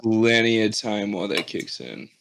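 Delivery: ordinary talk, nothing marked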